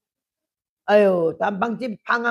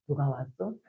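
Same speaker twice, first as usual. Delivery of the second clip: much lower, much softer reply; quietest tone; 9 semitones lower, 10 dB quieter than usual. Retold or imitated. Husband answering only her quietest tone, very soft. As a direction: answer in the smallest voice. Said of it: "제일 작은 톤에 맞춰서".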